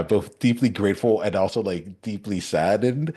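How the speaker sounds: smiling. Real, not acted. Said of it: "I'm smiling while I say it".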